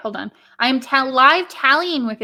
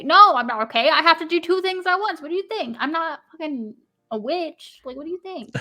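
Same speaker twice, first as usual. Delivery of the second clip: exasperated retort; 5 semitones higher than usual. Retold or imitated. Acts out flustered retort to co-host.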